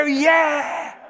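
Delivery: high, loud mock roar; crowd cheering. Preacher acting out the crowd's wildest answer, cheering. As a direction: imitate a crowd going wild.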